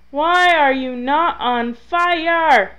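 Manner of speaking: loud, high, slow exasperated demand; frustrated yell. Real, not acted. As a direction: yell it at the game, stretching each word. Frustrated that fire trick fails.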